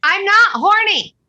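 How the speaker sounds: sharp, loud, high denial; indignant outburst. Acted. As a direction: blurt it out, offended and loud. Indignant denial in group therapy.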